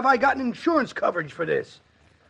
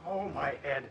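a much softer moan of pain; pained groan; 6 semitones lower, 14 dB quieter than usual. Acted. Struck man nursing his head, softly moaning. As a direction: groan it out low and hurt.

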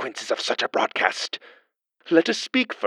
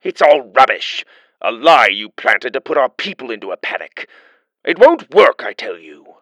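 louder, blustering official; angry denial; 9 dB louder than usual. Acted. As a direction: bluster to keep the panic down. Chancellor angrily denies.

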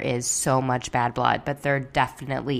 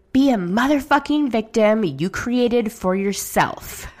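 mild mock shout; 6 semitones higher than usual. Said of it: "And she shouts".

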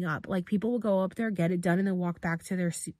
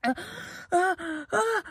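repeated high gasped "ah"; acted retching cries; 12 semitones higher, slower than usual. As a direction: imitate dry heaving over a bucket. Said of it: "I was just like".

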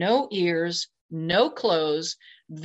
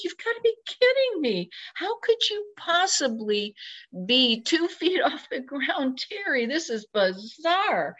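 much higher, half-laughing incredulity; amazed disbelief; 7 semitones higher than usual. Retold or imitated. Floating off the ground, talking to himself in high laughing disbelief. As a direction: marvel at the impossible, giggling. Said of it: "I'm like".

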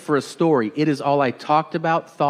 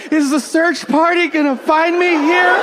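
much higher, desperate mom voice; a mom pinned down, pleading for rescue; trapped mom; 10 semitones higher than usual. Retold or imitated. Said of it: "that look on her face like".

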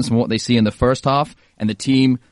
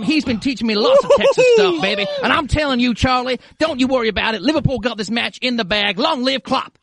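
high, hammed-up Texas twang; Texas accent; 8 semitones higher than usual. Host asked for Texas tells Charlie not to worry, Texas accent. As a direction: put on a Texan accent, pitched well up. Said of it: "Yeah, do a Texas accent".

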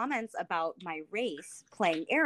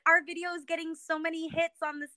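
mock excited kids; 8 semitones higher than usual. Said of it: "they were like".

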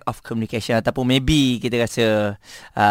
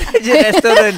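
laughing; 11 semitones higher, 7 dB louder, much faster than usual. Real, not acted. Laughing at restaurant manager suggestion.

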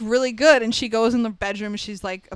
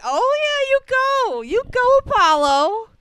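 high, loud whoop of encouragement; rooting for a favorite; cheering on; 13 semitones higher, 5 dB louder than usual. Retold or imitated. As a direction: cheer him on, pitched way up. Cheering Apollo on in retelling.

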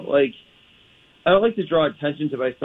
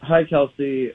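normal talking voice

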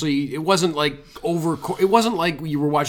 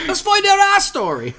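laughing; 11 semitones higher, 6 dB louder than usual. Retold or imitated. Continuing the gag voice, laughing.

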